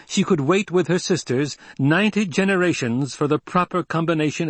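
high, forceful public proclamation, slowing at the end. A narrator's reading. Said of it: "Paul raised his voice".

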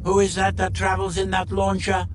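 rigid, mechanical demand; Monoid interrogator. Acted. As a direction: question like a droning alien. Monoid questions the Doctor.

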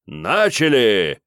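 loud, slow drawn-out herald's bark; town crier. A narrator's reading. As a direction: bark the start to the whole field. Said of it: "рявкнул из-за золотых ворот голос Герольда".

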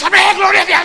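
imitating the crowd's reflex; mock crowd shout. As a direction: shout it without thinking. Congregation shouts automatically.

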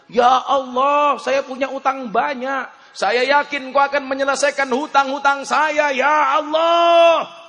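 acted impassioned supplication, building to a shout; fervent loud prayer. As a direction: cry out the prayer, stretching the last words. Acts out a fervent debtor's prayer.

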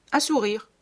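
plain reading voice

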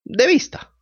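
evasive little puppet; high puppet voice. A narrator's reading. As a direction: answer quickly and high. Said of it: "respondió la marioneta".

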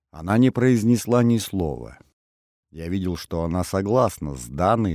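ordinary delivery

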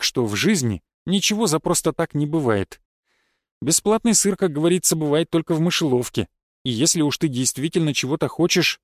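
usual speaking voice

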